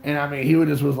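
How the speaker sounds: ordinary talk, nothing marked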